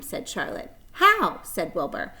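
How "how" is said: very high, loud question; eager. Acted. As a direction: blurt it out eagerly.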